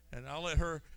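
normal talking voice

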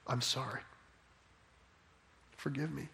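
humbled and almost gone; contrite whisper. Retold or imitated. Job's contrite reply, very soft.

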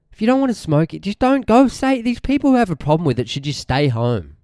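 high, mocking whiny voice; sneering mock of the anti-smoking crowd; mocking whiny anti-smoker.

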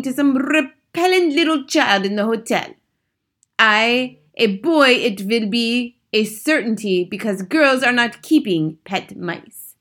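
put-on high, loud accented Grand High Witch; shouting witch. A narrator's reading. Grand High Witch explaining the mice to witches, imperious shouting. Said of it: "she shouted".

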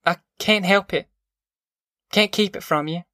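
humble, faltering apology. A narrator's reading. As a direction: helplessly excuse yourself, haltingly. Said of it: "She faltered".

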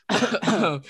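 imitated cough from the track; short staged cough; demonstrated cough. Shown as a demonstration. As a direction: cough as if recording it for a beat. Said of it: "I'm coughing".

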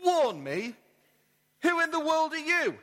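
incredulous question, jumping high at the end; mock-incredulous burglar; relaxed, incredulous burglar. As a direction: question the parrot in disbelief, pitch climbing. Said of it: "The burglar relaxed".

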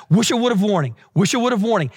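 quick run-together "wish I would've warning", repeated; really fast. Shown as a demonstration. Preacher has the congregation say the phrase fast, rapid-fire. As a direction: rattle off "wish I would've warning" as one catchphrase. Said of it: "Say that really fast".

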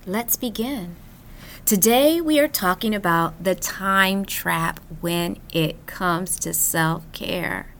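normal talking voice

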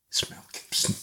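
hushed, breathless whisper of disbelief; stunned whisper. Retold or imitated. Wife whispers to husband who's on the phone, awed whisper. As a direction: whisper it like it can't be true.

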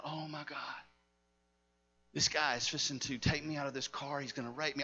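low, soft voicing of frightened thoughts; retold hushed dread. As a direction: act out her dread under your breath.

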